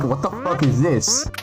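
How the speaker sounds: ordinary talk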